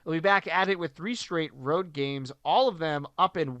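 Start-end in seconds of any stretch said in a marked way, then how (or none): none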